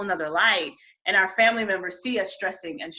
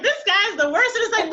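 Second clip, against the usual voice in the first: laughing mock vent; 10 semitones higher, 4 dB louder than usual.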